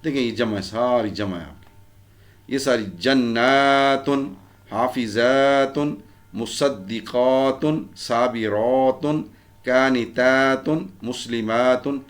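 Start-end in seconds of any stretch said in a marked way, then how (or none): 3.0-4.3 جناتن with "naa" held long before "tun"; slow recited جناتن, "jan-naaa-tun"; long plural ending. Shown as a demonstration. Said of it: "یہ ساری آپ کی جمع ہے". 4.7-12.0 slow list from حافظاتن to مسلماتن, each aa held before "tun"; measured recitation, long aa in قانتاتن and the rest; long plural endings. Shown as a demonstration. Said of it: "یہ ساری آپ کی جمع ہے".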